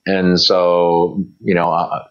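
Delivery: ordinary talk, nothing marked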